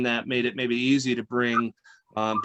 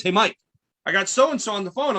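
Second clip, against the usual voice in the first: raised pitch, eager retelling of an excited coworker; mock excited announcement; excited quote; 7 semitones higher, 3 dB louder than usual. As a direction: play the eager new hire. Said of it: "He was so excited".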